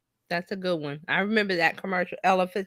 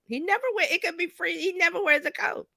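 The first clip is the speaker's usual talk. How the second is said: high-pitched mock disbelief; retold incredulous objection; 9 semitones higher than usual. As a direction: protest it high, as if baffled by what you see. Retelling disbelief at picture.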